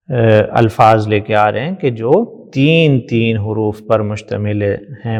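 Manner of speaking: ordinary delivery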